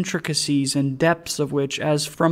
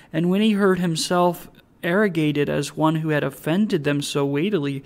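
plain reading voice

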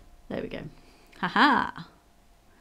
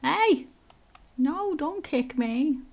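high protest; 7 semitones higher, much slower than usual. Pretend. Goose being kicked, protesting.

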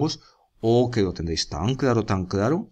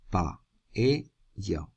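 Paella as PA... E... LLA; three isolated beats with long pauses in Paella; split into syllables; 4 dB quieter than usual. Shown as a demonstration. Teacher showing a hiato, Paella split pa... e... lla. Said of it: "Hiato, la I y la E no se quieren".

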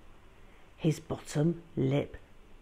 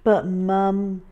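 ordinary delivery, nothing marked

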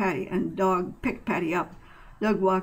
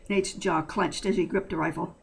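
usual speaking voice

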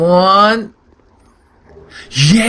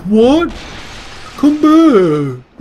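voiced NPC; 8 semitones higher, much slower than usual. Voicing angry NPC as player escapes.

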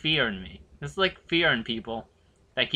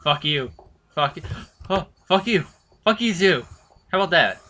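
loud, high angry yelling; furious cursing; 4 semitones higher, 6 dB louder than usual. Real, not acted. Gamer swearing at the monster chasing him, furious. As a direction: spit the curses out in real rage.

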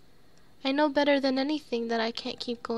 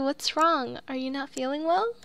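ordinary talk

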